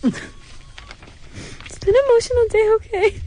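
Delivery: high, slow and close to tears; tearful. Real, not acted. Upset co-host defends the morning's tears, tearful. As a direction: draw it out, high and teary. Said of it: "She has tears".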